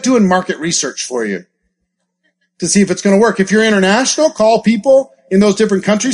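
ordinary talk

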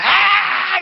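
high, drawn-out mock wail; imitated wailing scream. Preacher retells the pastor's reaction, imitated wail. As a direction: imitate a loud, long cry. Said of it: "gritaba y lloraba".

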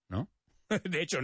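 normal talking voice

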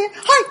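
high, loud startled "ay"; startled cry. Retold or imitated. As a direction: let out a sudden cry of recognition. Suddenly recognising her own son.

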